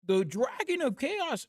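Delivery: a quick try at a grave professor, then give up; Jordan Peterson attempt. Chat requests Jordan Peterson, high slow attempt. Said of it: "do Jordan Peterson?".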